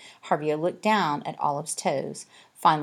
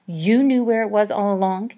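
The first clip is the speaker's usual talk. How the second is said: hissed; 5 semitones higher, 6 dB louder than usual. A narrator's reading. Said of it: "he hissed".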